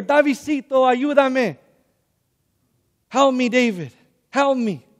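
high, loud cry for help; retold screaming. Possessed grandmother screaming for her grandson, retold as a loud plea. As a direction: call out desperately, imitating a scream. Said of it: "Grandma is screaming out your name".

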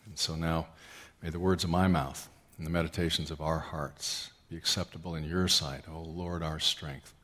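low, soft, earnest prayer voice; pastoral prayer. Acted. Pastor praying aloud for the congregation, hushed and earnest.

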